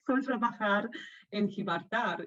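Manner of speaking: Spanish accent on halting Spanish words; Spanish twang. Shown as a demonstration. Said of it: "I've got the twang down".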